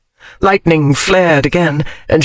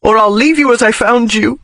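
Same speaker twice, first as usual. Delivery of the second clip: tearful threat; 6 semitones higher than usual. Acted.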